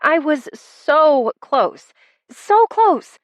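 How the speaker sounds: high, drawn-out anguished lament; despairing. A narrator's reading. She mourns a near win at cards, high despairing lament. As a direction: wail it lightly, pitch climbing on the repeat. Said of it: "Utter despair clawed at my emotions".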